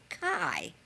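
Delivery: "Kai" for cry, the r left out; baby-talk "Kai", dropping low at the end; toddler's "cry". Retold or imitated. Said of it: "and she said".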